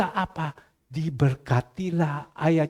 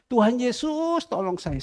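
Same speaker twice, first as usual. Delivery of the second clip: higher call, falling at the end; pleading prayer; 10 semitones higher than usual. Retold or imitated. Praying on early mornings, pleading. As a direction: call out to Jesus for help. Acted prayer crying to Jesus.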